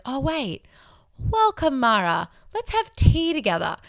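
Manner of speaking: lightly high, gentle welcome; channeling the Buddha. Retold or imitated. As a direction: play the Buddha kindly. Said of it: "channel the Buddha".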